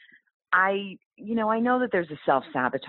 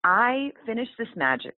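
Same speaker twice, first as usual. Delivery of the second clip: normal talking voice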